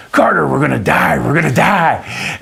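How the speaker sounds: high, loud mock terror on the drop; hammed-up kid yelling they'll die; mock panic. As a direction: scream it like the ride is falling. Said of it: "he just goes".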